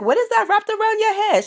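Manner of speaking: very high New York accent; incredulous question in a New York accent. Shown as a demonstration. Girl asking about a hair wrap, high New York accent. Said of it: "give you my, um, New York accent".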